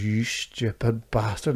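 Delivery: slow, low, deadpan "you stupid bastard"; mate's slow contemptuous verdict; deadpan insult. Retold or imitated. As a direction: drag it out flat and disgusted. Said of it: "he just looks at me and goes".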